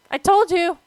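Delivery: loud, high-pitched "see, I warned you" cry; loud protest. Real, not acted. Startled by boss spider's attack.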